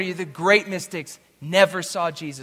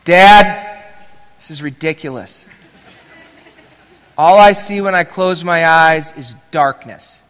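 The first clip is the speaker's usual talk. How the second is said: loud imitated kid's protest; exasperated protest; 12 dB louder than usual. Son tells Dad he only sees darkness, exasperated protest. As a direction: act out a child fed up with Dad.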